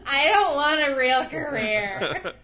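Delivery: loud, hammed-up wail with laughter; slow, laughing fake-crying complaint; mock tantrum. Mock tantrum about a real career.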